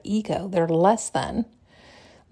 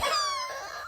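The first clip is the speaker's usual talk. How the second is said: high giggle; 9 dB quieter than usual. Real, not acted. Speaker laughs at herself.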